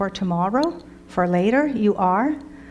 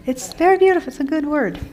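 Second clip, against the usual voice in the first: excited; 6 semitones higher, much faster than usual. Real, not acted. Teacher enthusing about word.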